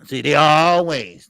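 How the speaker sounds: slow, loud, hammering the point; fired-up ranter; loud emphatic rant. Real, not acted. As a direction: lean in hard and slow. Ranting about critics.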